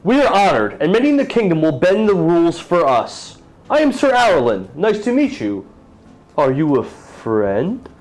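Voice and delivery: jovial knight with his horse in a tavern; proud knight. Acted. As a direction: boast like an honored knight.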